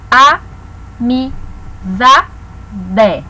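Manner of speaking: "A, mi, za, de" as four separate beats with clear pauses; one voice burst per syllable in "A, mi, za, de". Shown as a demonstration. Teacher showing division through pronunciation, syllable by syllable. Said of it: "pronúncia da palavra".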